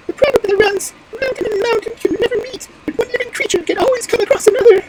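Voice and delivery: very high squeaky bird voice; high-pitched little bird reciting; proverb-quoting wood pigeon. Acted. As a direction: recite it as a tiny piping pigeon.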